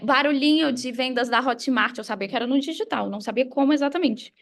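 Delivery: usual speaking voice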